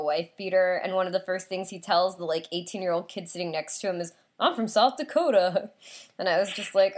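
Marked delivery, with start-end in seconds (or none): 4.4-5.7 lightly imitated Southern accent. Bus passenger introducing himself to the kid beside him, mild Southern accent. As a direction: mimic him with a touch of Southern. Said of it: "like, a Southern accent".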